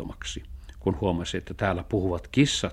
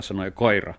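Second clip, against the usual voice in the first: plain reading voice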